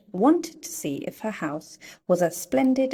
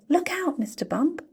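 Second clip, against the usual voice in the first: high alarmed warning cry; cried out; 10 semitones higher than usual. Acted. Mister Busy warning Mister Bump at the fountain. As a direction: warn him before he falls. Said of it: "cried Mister Busy".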